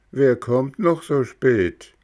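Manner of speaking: lightly voiced high snail calling down. Schnecke ruft aus dem Fenster. (leicht höhere Schneckenstimme)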